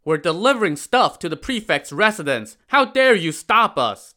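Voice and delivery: loud, high outraged reply; how dare you, at full voice; indignant porters. Acted. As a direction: porters offended at being stopped, loud. Porters argue with soldiers.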